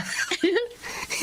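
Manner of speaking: soft, unvoiced mocking snicker; quiet mean snicker; breathy snicker. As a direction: snicker behind her back. Mocking Eileen as stupid.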